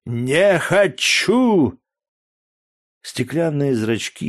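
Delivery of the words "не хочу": не хочу yelled higher and louder; slurred drunken outburst не хочу; drunken shout. A narrator's reading. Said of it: "еле ворочая языком". (пьяный выкрик не хочу)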